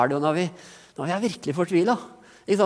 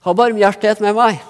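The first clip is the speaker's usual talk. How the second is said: high, loud cry for mercy; a beggar crying out, desperate for mercy; 4 semitones higher, 8 dB louder than usual. A narrator's reading. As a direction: cry out with everything you have. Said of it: "så begynte han å rope".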